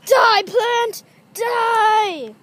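loud, high battle shout; hammy killing cry; mock attack yell. Shown as a demonstration. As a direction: scream at the plant as you strike. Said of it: "this is how I kill plants".

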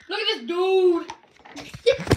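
drawn-out teasing. Acted. Repeated drawn out.

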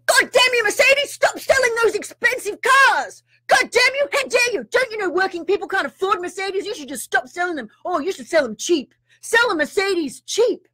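high, hammed-up indignant shout at a carmaker; mock outraged rant. Mocking judgmental people by ranting at Mercedes, mock outrage. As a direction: squeal it like a scandalised moraliser. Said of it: "And we don't say".

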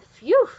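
high, loud puff of relief; relieved phew. A narrator's reading. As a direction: let out a big relieved phew. Dog relieved after the escape.

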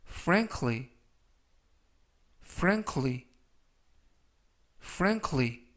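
frankly said clearly three times, with pauses to repeat; careful pronunciation demo. Shown as a demonstration. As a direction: model frankly for the class. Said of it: "라고 발음하시면 되겠습니다". (frankly를 또박또박 세 번 발음 시범)

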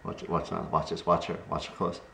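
quiet low murmur; hushed. Real, not acted. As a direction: murmur so not to disturb. Hushed while watching dog.

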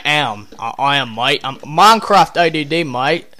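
playful British or Australian mate-speak; joking Aussie-Brit accent, pitch lifting; mock British-Australian accent. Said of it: "talking like a British person or an Australian".

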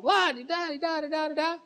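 high sing-song babble; mock wife chatter. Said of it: "she comes home".

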